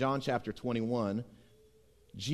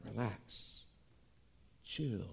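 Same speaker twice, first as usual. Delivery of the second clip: quiet; 5 semitones lower, 13 dB quieter, much slower than usual. Shown as a demonstration. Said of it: "Don't shout".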